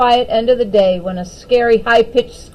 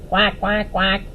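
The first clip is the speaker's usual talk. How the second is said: quacking like a duck; duck quacks; 3 semitones lower, 3 dB quieter, slower than usual. Acted. Opal imitating a duck for friends, quacking. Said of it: "and a duck".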